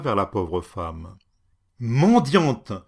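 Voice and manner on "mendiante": higher, much louder, barked insults; furious shout. Acted. Enraged lord shouting at a poor woman. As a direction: yell at the beggar woman. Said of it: "cria-t-il".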